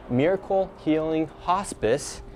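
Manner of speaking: ordinary delivery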